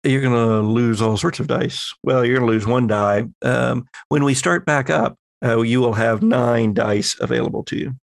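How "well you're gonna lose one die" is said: usual speaking voice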